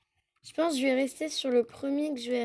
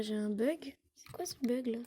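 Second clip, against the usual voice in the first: whispered aside to herself; whispering; 7 dB quieter than usual. Real, not acted. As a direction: whisper it under your breath. Aside to herself about a bug.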